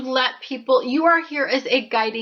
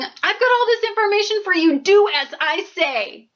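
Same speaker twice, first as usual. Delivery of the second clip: bossy ego; 7 semitones higher than usual. Retold or imitated. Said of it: "Ego state is saying".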